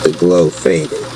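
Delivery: ordinary delivery, nothing marked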